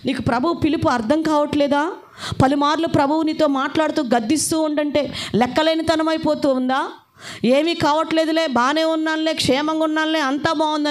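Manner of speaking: high, pleading preacher's appeal; fervent preaching. Acted. Preacher urging the congregation to heed God's call, fervent and high. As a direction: press the call home to the congregation.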